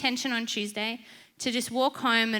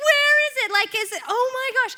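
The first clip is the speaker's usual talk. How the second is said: high, frantic, rushed searching cry; hammed-up panic, voice way up; freaking out; 12 semitones higher, 6 dB louder, faster than usual. Woman hunts for lizard in her shirt, frantic acted panic. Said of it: "I so start freaking out".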